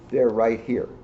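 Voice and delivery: plain reading voice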